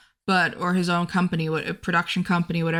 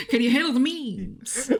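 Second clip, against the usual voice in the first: higher, laughing imitation of the actor; Denzel impression; 6 semitones higher than usual. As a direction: play the clip line with a grin. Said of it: "that's his only clip".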